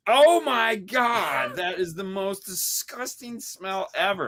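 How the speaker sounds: high, slow, shrieking imitation of Haley; disgusted shout. Friend mimics Haley reacting to the jerky smell, shrieking. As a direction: squeal it out, drawn-out disgust. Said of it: "and Haley just goes".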